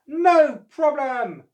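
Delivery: very high, loud, slow confident reply; eager. Acted. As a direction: promise it eagerly. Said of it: "replied Oscar".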